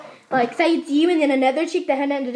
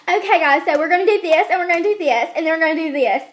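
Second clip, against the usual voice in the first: teacher getting carried away; 2 semitones higher than usual. Retold or imitated. Student imitates teacher whose instructions end up piling up, raised and insistent. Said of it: "And then it'll end up".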